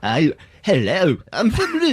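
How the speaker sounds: slow "hello", then a squeaky high jump on "from", in a made-up Leicester accent; goofy invented Leicester accent with a pitch leap; silly made-up Leicester accent. Shown as a demonstration. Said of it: "I'm just doing things with my voice".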